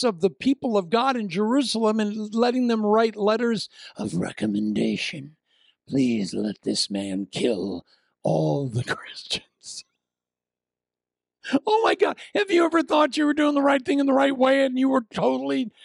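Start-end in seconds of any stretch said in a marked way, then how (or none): 5.9-9.8 slow, whispered request with a laugh; sly whisper. Pretend. Preacher voicing Saul asking the priests, slow whisper.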